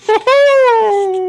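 very high, loud whoop; woohoo cheer. Real, not acted. Player cheering a friend's big roll, high whoop. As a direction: whoop with glee.

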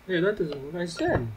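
put-on high-pitched scolding old man. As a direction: squeak it like an annoyed old teacher.

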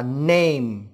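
"name" with a single Vietnamese e instead of the ei glide; wrong E vowel. Shown as a demonstration. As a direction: stretch "name" out as nem. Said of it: "không đọc lái sang âm e". ("name" đọc sai thành âm e)

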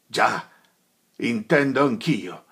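suddenly loud and troubled; agitated outburst. Acted. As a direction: cut in, upset. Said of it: "interruppe Renzo, stravolgendo gli occhi".

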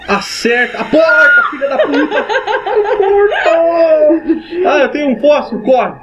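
high frantic laughing yells; panicking gamer. Real, not acted. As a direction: shriek while running away. Chased, fleeing through door.

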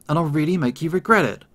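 ordinary delivery

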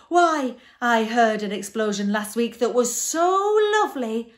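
loud, high, gushing monster voice; emotional outburst. A narrator's reading. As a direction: belt it out, overcome by how lovely it was. Din gushes over an explosion.

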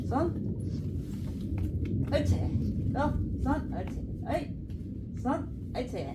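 high commands to the dog; cheerful orders to the pet. Real, not acted.

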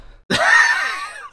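high, squealing laugh; helpless laughter. Real, not acted. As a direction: burst out laughing high and loud. Cracks up after own explanation.

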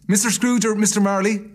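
high, loud polite enquiry; charity gentleman. Acted. Gentleman addresses Scrooge formally.

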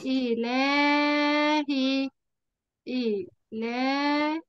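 إلهي stretched very slowly as ilaahi; drawn-out demo word. Shown as a demonstration. Teacher listing words with a hidden alif, very slow. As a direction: sound out إلهي with a long aa. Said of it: "إيه كمان؟".

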